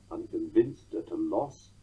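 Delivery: "unconvinced at a loss" with stress on VINCED and LOSS; titty-TUM rhythm on "unconvinced at a loss"; anapestic beat. Shown as a demonstration. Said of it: "a dimeter of six".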